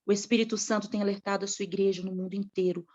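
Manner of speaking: usual speaking voice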